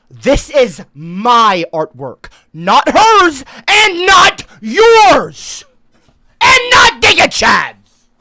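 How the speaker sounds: starts firm and slow, then breaks into a high, loud yell; building to a shout. Real, not acted. Streamer claiming his art over wife and chat, building to a yell. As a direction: climb from stating it to screaming it.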